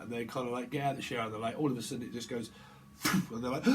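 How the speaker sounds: ordinary delivery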